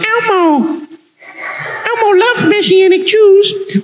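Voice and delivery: chirpy Elmo, way up high; a kid-show monster voice, pitched high. Pretend. Kids' Sesame Street characters game.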